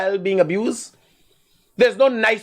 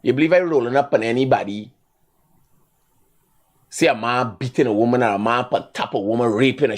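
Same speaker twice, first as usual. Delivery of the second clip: usual speaking voice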